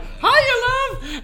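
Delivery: high, loud mock greeting; called-out greeting. Friend hands her a cocktail mid-trick, high loud greeting. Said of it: "She's like".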